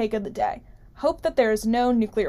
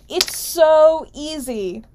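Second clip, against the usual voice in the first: exasperated; 5 semitones higher, 6 dB louder, much slower than usual. Acted. Exasperated at Oz's blunder.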